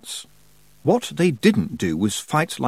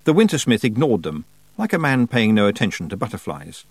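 usual speaking voice

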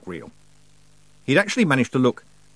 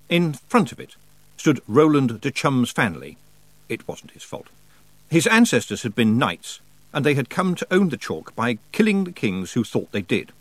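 usual speaking voice

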